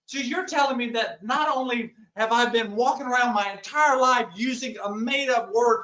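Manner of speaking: ordinary talk, nothing marked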